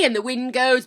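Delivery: ordinary delivery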